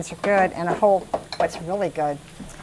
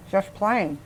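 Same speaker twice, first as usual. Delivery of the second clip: mouth full; 4 dB quieter than usual. Real, not acted. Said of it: "Oh, your mouth is full".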